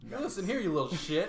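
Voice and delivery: high, loud mock telling-off; mock scolding. Player playfully tells off a fellow player, loud mock outrage.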